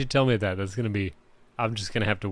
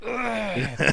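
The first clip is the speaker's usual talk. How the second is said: higher mock throat-clear with a laugh; dad clearing throat; 12 semitones higher than usual. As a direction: fake a dad's throat-clear, half laughing. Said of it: "Dad clearing throat".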